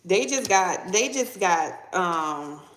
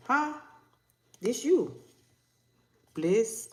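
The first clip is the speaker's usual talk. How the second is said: ordinary delivery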